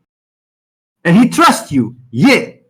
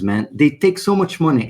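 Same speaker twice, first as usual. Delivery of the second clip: ordinary talk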